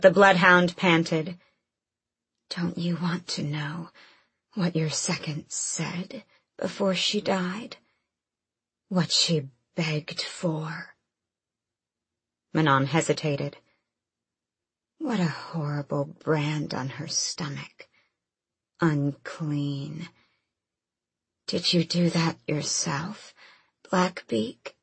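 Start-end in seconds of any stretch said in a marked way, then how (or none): 2.5-11.0 slow rasping monster whisper; creepy creature whisper. A narrator's reading. Bloodhound taunting Manon. 15.0-24.7 hushed taunting monster voice; creepy creature whisper. A narrator's reading. Bloodhound keeps taunting.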